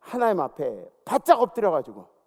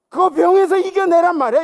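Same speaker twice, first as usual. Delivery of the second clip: higher, louder preaching push; preacher raising his voice to urge them; urgent exhortation; 7 semitones higher, 7 dB louder than usual. Acted. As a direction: drive it home to the pews. Preacher urging congregation.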